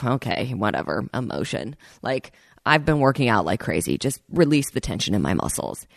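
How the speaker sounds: dismissive inner voice, dropping quiet; a mild, brushing-it-off whatever; skeptical. Retold or imitated. To herself on the massage table, mildly skeptical and dismissive. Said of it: "I was, like, a little skeptical".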